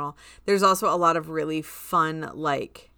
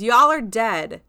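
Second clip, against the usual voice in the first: loud, slow, higher declaration; menacing announcement; 5 semitones higher, 10 dB louder, slower than usual. Retold or imitated. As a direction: drag it out loud like a gleeful villain. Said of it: "And Richard goes".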